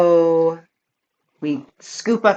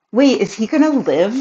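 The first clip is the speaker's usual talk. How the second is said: higher, louder sudden wait; retold surprise, a bit louder; surprised realisation; 6 semitones higher, 4 dB louder than usual. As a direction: catch yourself mid-panic, mock startled.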